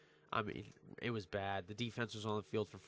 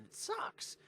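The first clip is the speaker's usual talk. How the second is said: high, whispered pained whine; whiny groan; 4 dB quieter than usual. Real, not acted. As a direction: whine it like it physically hurts. Pained sports fan groan.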